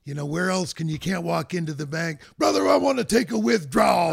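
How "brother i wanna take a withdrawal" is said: loud wrestler shout, laughing. Retold or imitated. Mock wrestler line at bank.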